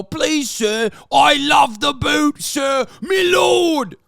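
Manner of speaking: mock peasant pleading to his lord; groveling peasant. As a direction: beg like a grovelling serf. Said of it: "peasants ... go".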